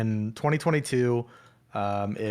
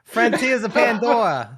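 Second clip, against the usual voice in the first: loud, high Boston accent; 10 semitones higher, 6 dB louder than usual. Shown as a demonstration. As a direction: Frontiers of Pandora said in a thick Boston accent. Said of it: "can you say Avatar Frontiers of Pandora".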